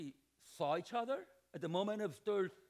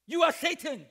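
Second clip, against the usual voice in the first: a loud, sudden accusing turn; mock accusation; 5 semitones higher, 9 dB louder than usual. Jesus turning on Peter, loudly accusing. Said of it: "That's passive aggressive".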